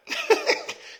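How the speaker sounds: sudden high burst of laughter; squeaky real laugh. Real, not acted. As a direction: break into a high laugh at own joke. Laughs after Sebastian joke.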